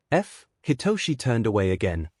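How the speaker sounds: ordinary delivery, nothing marked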